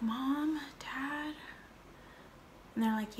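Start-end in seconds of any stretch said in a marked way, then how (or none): 0.0-1.3 put-on soft, higher, hesitant kid voice; timid calling. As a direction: call softly for parents, afraid to confess. Retold timid approach to parents.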